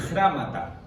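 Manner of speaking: "grámata" with an A in the stressed first syllable; mispronounced Greek word. Shown as a demonstration. Teacher misnaming the Greek word, mistaken pronunciation of "grámata". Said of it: "grémata, perdón".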